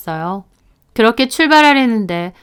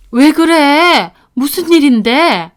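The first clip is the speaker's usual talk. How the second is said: drawn-out, higher worried question; alarmed mother-in-law; 5 semitones higher, 3 dB louder, slower than usual. Acted. Mother-in-law sees daughter-in-law crying, alarmed. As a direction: ask in sudden alarm, very slowly.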